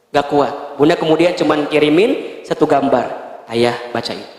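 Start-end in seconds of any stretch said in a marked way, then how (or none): 3.5-4.3 low and quiet, holding back tears; whispered hurt request to her husband; hurt, soft plea. Acted. Wife hands over the evidence.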